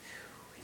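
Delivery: unvoiced breath of relief; relieved whispered whew. Real, not acted. Resting in the quiet woods, a soft relieved whew. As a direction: let the peace out in a whispered whew. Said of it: "speaks peace to my spirit".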